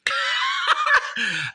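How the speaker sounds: a sudden high burst of laughter. Real, not acted. Cracking up at his own girl-trouble story with a high laugh.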